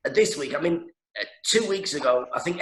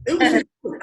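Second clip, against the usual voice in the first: mock jealous partner; 12 semitones higher, 8 dB louder, faster than usual. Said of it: "She's like".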